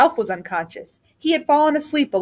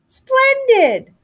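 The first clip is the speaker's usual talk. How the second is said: high, loud, delighted crow; a sudden cry of witchy delight; crowing witch; 13 semitones higher, 4 dB louder than usual. A narrator's reading. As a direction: let the witch shriek with glee. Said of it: "crowed the witch".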